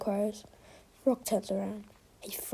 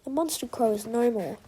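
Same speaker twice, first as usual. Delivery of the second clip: ordinary delivery, nothing marked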